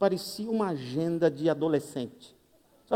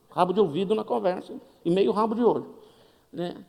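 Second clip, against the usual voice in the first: usual speaking voice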